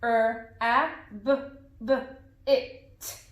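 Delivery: "Er, a, b, b, it" as separate phonics sounds; sounding out. Shown as a demonstration. Teacher reads the sounds in the word, "Er, a, b, b, it" one by one. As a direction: pause between sounds: "Er", short "a", buh, buh, "it". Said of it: "Let's do it again".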